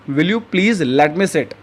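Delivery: very slow, each word spaced out for learners; slow teaching model. Shown as a demonstration. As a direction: say it slowly and clearly like a teacher modeling a phrase. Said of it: "English में politely कैसे कहेंगे".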